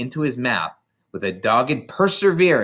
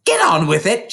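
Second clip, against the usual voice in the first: higher, louder shout; 7 semitones higher, 5 dB louder than usual. A narrator's reading. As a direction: yell at him to hurry up. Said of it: "she shouted".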